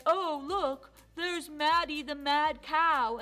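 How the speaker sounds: slow, loud, sneering mock of a schoolmate; mean taunting. Bully cow jeering at Maddie on the bus, loud and sneering. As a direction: drag out the tease so the whole bus hears. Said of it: "she would say things that were mean".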